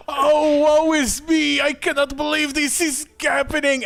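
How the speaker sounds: high, hammed-up mock lament; crocodile-tears mourner wailing to the crowd; fake wailing. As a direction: overplay a fake grief, pitched high. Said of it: "start crying with crocodile tears".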